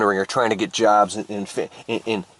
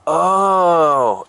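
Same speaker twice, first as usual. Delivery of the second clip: drawn-out, higher and louder "oh"; dawning realization; 7 semitones higher, 5 dB louder than usual. Acted. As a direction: let the penny drop out loud. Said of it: "when he finally realizes, he goes".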